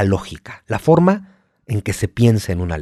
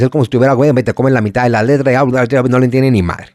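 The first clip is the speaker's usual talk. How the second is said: put-on fast, louder swallowed-consonant Sevillian accent; 5 dB louder, faster than usual. Host imitating a Sevillian like [name], fast and loud. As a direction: rush it, eat half the letters. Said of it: "El sevillano habla un poco más así como [name]".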